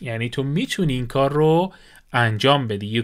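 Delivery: ordinary talk, nothing marked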